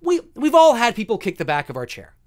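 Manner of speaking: ordinary talk, nothing marked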